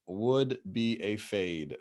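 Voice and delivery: slow, weighty repeat; slow drawn out. Real, not acted. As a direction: stretch each word out. Repeats call slowly for emphasis.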